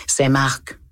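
put-on a small boy answering a policeman shyly; little boy. A narrator's reading. Eight-year-old boy answers the lieutenant, timid child voice.

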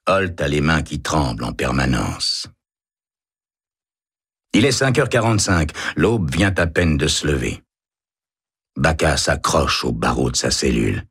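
ordinary delivery, nothing marked

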